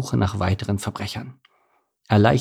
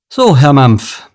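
loud, slow, raised official address; confronting; 4 semitones higher, 10 dB louder, slower than usual. A narrator's reading. Officer catching Mike out, loud and deliberate. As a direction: announce it loudly, drawing out each word.

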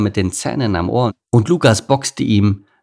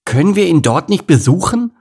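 high, loud cub pleading; raised childlike eagerness; excited question; 10 semitones higher, 6 dB louder than usual. A narrator's reading. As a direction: burst out asking like an eager kid. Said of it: "fragte Lukas aufgeregt".

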